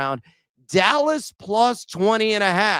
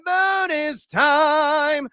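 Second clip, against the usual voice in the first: drawn-out shout; 9 semitones higher, much slower than usual. Acted. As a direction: stretch bonus time out like a game-show jingle. Echoing the segment name.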